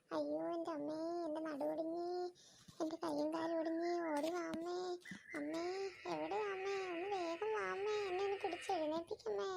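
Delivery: put-on wailing child after a fall; crying for mom. Fallen boy calls his mother, wailing. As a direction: whimper and wail for mother to come.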